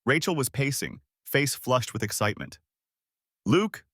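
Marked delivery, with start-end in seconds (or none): 3.4-3.8 loud, high, theatrical call of his name; dramatic announcement. A narrator's reading. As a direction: announce it like a show host. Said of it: "she announced dramatically".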